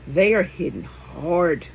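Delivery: normal talking voice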